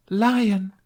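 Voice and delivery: high, loud theatrical exclamation; crying out. A narrator's reading. Knight reproaches the interfering lion, crying out high. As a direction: cry it out as a stage knight. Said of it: "Tooth cried".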